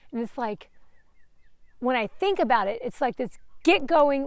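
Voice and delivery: usual speaking voice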